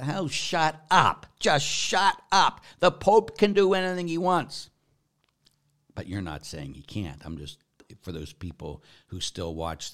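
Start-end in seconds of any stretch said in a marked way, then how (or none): 0.1-4.7 loud, high, drawn-out telling-off; real fed-up raised voice; exasperated outburst. Real, not acted. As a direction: tell the critics off, stretching each word. Exasperated retort to the Pope's critics.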